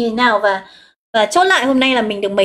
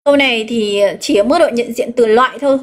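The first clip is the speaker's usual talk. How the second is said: normal talking voice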